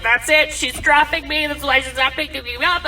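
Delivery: loud, high dramatic lament; mock despair. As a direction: wail it like a jilted dad. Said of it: "super depressed and sad because he was like".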